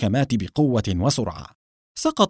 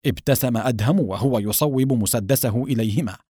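usual speaking voice

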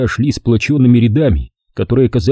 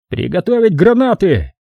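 higher commanding call; 8 semitones higher than usual. A narrator's reading. Sergeant ordering grenades, higher command. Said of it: "приказал фельдфебель".

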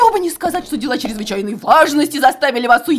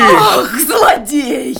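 angry; 4 dB louder, much slower than usual. Acted. Betrayed wife lashes out.

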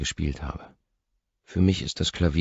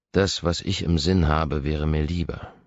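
ordinary delivery, nothing marked

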